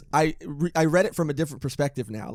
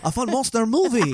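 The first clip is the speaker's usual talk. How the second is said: higher, laughing mock Mexican accent; Guillermo del Toro; 6 semitones higher than usual. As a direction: join in the hammed-up director impression. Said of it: "I'm Guillermo del Toro".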